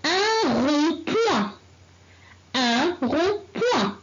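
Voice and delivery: Un rond-point, said slowly with each syllable clear; slow, careful teacher repetition; slow model reading. Shown as a demonstration. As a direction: read it slowly for the class to copy. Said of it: "on commence la lecture".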